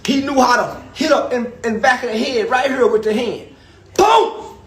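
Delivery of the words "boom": high, loud "Boom!"; punch sound effect. Acted. Acting out Uncle Wayne's hit on the head, loud boom. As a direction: hit the word like a blow.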